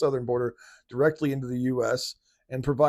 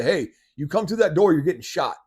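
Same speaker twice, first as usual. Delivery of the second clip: higher, louder threat at the door; pretend warning shout; 6 semitones higher, 7 dB louder than usual. Homeowner warning an intruder at the door, loud threat. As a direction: bark a warning at a burglar.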